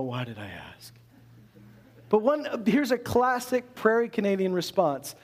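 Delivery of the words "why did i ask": muttered regret. Retold or imitated. Mock regret at question.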